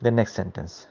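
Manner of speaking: ordinary talk, nothing marked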